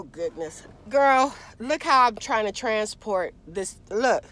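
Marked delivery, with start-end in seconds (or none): none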